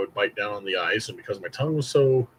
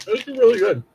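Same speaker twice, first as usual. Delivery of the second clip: high, loud this is really good; delighted; 13 semitones higher, 7 dB louder than usual. Real, not acted. Tasting candy for the camera, delighted and loud. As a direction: gush over the candy.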